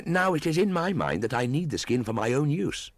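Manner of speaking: put-on high, cool boyish voice; a defiant jungle boy mocking the hunter; scornful boy, pitched high; young Mowgli.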